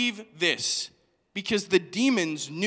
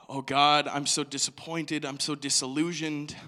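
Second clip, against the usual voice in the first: low, heavy confession to God; understated, low-voiced discouragement; disappointed lament; 6 semitones lower than usual. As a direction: sigh it out in lowered pitch, disillusioned. Voicing own private despair.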